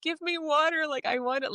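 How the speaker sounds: high, thin, needy plant voice; voicing the plant. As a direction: speak as the thirsty houseplant calling out. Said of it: "it's talking".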